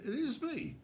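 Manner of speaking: high burst of realisation; the penny drops, high and startled; high and stunned; shocked realisation. Retold or imitated. Realising he is the subject.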